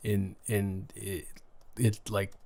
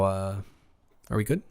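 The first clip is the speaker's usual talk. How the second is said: ordinary talk, nothing marked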